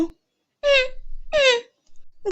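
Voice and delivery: put-on tiny high-pitched child's hum; soft, high little-girl mm; small child. A narrator's reading. Small child answers by humming. (همهمة طفلة صغيرة)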